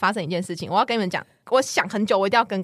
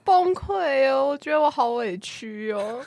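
milk the feeling of being wronged, half laughing; wronged and whiny; 3 semitones higher, slower than usual. Real, not acted.

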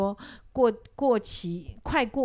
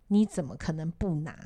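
ordinary talk, nothing marked

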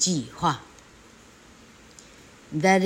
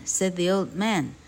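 usual speaking voice

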